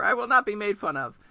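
an indignant frog protesting; indignant Grover. Acted. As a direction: puff up and protest being mocked.

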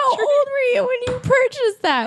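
very high, squealing laughter through the words; helpless giggly questioning; teasing through laughter. Real, not acted. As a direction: tease while cracking up. Teasing friend while cracking up.

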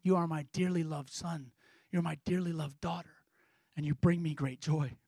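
very soft, low, hushed voice; Father's tender blessing. Acted. The Father speaking over his children, hushed and tender. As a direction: speak it gently over them, low and near a whisper. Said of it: "words the Father speaks over his children".

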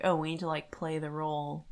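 normal talking voice

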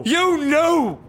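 very high, loud scream; furious scream. Acted. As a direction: scream the accusation. Quarrel climax.

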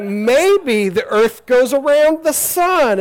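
loud, high, stretched-out suggestion; hammed-up bold proposal. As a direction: float a daring idea slowly and loudly. Acts Copernicus proposing his bold idea.

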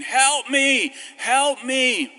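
loud, high cries for help; retold screaming. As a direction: yell it like a frightened patient. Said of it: "this woman screaming".

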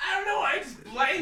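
tipsy, words a bit loose. Real, not acted. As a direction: speak like you've had a few. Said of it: "I'm really drunk right now".

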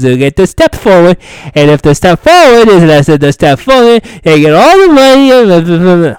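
loud, high, rambling mock-confused voice; mocking fan rant. Mocking clueless fans who over-explain the rules, loud high ramble. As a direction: parody the fandom's rambling logic, loud and high. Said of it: "knowing how we are with this, it's just gonna be".

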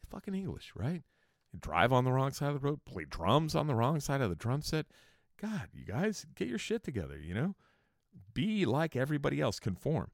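high-pitched mock exasperation; mock scolding. Teasing the English about driving on the wrong side, mock scolding.

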